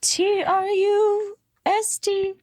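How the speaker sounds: high, slow letter-by-letter spelling; spelled out high. Pretend. Spelling it out playfully.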